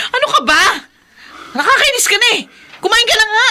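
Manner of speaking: high, loud and irritated nagging; exasperated. Acted. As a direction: snap at him, fed up. Wife losing patience with Samuel. (inis na pagsigaw)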